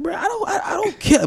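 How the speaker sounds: high mock yell of not caring; mock shout. Retelling what he yells at critics, high mock shout. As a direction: shout it up high like you truly don't care. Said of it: "I'm like".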